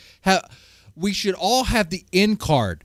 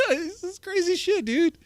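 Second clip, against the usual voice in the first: laughing; 11 semitones higher than usual. Real, not acted. Laughing after the yell.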